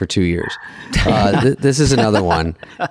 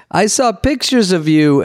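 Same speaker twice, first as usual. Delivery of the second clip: high, slow, slightly German waiter voice; dubious German accent; 9 semitones higher, slower than usual. Retold or imitated. Waiter needling a regular about tabloid photos, high off-target German accent. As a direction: draw it out in a barely German accent. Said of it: "Is this your German accent?".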